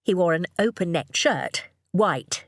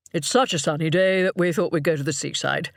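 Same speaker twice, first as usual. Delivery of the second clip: father's voice. A narrator's reading. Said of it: "Richard told him".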